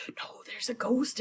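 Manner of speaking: hushed whisper of fear; a frightened whispered warning; scared whisper. Retold or imitated. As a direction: whisper it like something is watching. Macbeth insists he sees ghost.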